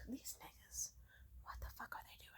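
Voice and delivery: sigh, then whispered disbelief; exasperated whisper. Acted. Despairing at drunk men at shows, exasperated whisper. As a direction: whisper it to yourself, fed up.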